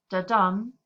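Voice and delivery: "da-dum" with "da" light and "dum" hit hard; spoken iambic beat; iamb rhythm. Shown as a demonstration. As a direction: tap out "da-dum", stressing "dum". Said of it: "where the second syllable is stressed".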